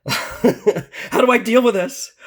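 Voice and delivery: slow and high through laughter; laughing. Real, not acted. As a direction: laugh out the question in disbelief. Laughs recalling friend's deck.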